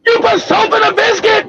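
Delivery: high-pitched disbelieving question; mock-astonished boss; incredulous accusation. Manager confronts his brother.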